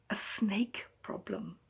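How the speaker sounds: slow, breathy whispered admission. Acted. Mayor confiding a secret problem to the snake catcher, whispered slowly. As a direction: let it slip out in a secretive whisper. Mayor looks nervously, hushed confession.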